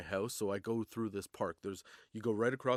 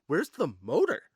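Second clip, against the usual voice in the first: higher, louder mock-incredulous question; incredulous retort; 4 semitones higher, 7 dB louder than usual. Drunk cyclist challenging the cop's claim, incredulous. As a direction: throw the challenge back, pitched up. Retold drunken argument with cop.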